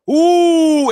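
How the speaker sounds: high, loud imitated crowd whoop; mock arcade crowd going wild; loud crowd "ooh". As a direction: holler a long, shocked ooh. Imitates crowd's reaction to fatality.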